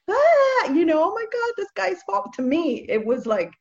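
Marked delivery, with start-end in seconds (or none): 0.1-2.3 high-pitched mock wail of alarm; mock panic. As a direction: imitate someone overreacting, pitched way up. Mimics others' alarm over covid.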